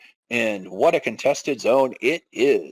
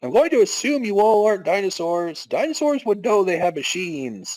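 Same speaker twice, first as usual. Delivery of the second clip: higher, earnest character voice; deadpan guard logic, pitched up; NPC townsperson; 6 semitones higher than usual. Acted. Guard reasoning with the party, higher earnest voice.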